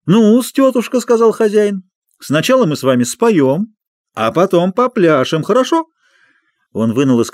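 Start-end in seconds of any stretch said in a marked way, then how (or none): 0.1-1.0 high loud clowning. Acted. Clown addressing the dog onstage. 2.2-5.9 clown voice. Acted. Clown addressing the dog onstage.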